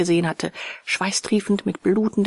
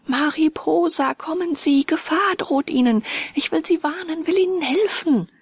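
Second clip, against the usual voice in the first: urgent pleading, much higher; imploring; 9 semitones higher than usual. Acted. Narrator warns Mariposa of danger, imploring and higher. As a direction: beg her to come as if conjuring her. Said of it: "wie beschwörend".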